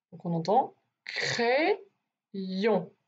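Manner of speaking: crayon said slowly as cray-yon, with the y sound; right crayon. Shown as a demonstration. Teacher to a child, showing the right sound: crayon drawn out as cray-yon. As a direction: stretch crayon into cray-yon, y clearly heard. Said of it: "on n'entend pas crayon".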